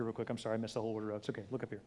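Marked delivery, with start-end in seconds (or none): none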